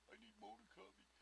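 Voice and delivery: mock hater whisper. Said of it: "They might go with a joke".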